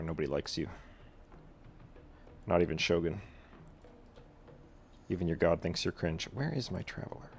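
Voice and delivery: ordinary talk, nothing marked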